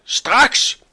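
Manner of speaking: very high, loud one-word outburst; furious command. A narrator's reading. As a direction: shout it as an urgent order. Furious butcher repeats his demand. (rasende, højt råb)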